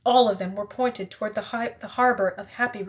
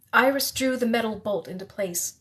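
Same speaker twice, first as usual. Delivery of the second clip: usual speaking voice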